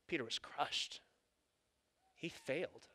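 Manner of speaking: soft and heavy, letting it sink; somber, hushed. A narrator's reading. Preacher telling of Peter's failure, softly sad. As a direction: drop the voice for the fall.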